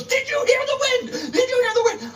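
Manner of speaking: she cries out in overwhelmed excitement, laughing through it; excited half-scream. Retold or imitated. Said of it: "literally almost screaming at me".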